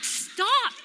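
lightly acted spouse calling a halt; exasperated husband. Husband to wife fleeing from a bird, mock exasperation. Husband reacting to her running away.

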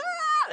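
high-pitched mock scream; sudden high retold scream; imitated scream. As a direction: shriek it briefly, imitating horrified onlookers. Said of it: "they're screaming".

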